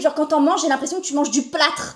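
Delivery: ordinary talk, nothing marked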